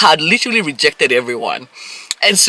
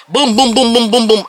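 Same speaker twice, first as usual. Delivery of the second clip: raised, punchy string of booms; mock barrage; 7 semitones higher, 4 dB louder than usual. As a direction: fire them off like rapid shots. Imitating a barrage of questions.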